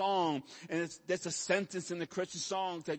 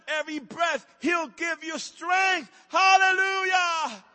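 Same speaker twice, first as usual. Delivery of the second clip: shouting, rising higher and louder; preaching at full force; 10 semitones higher, 9 dB louder than usual. Real, not acted. Pastor shouting strength over the congregation, fired up. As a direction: preach it out at the top of your voice. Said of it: "Okay, I'm getting excited".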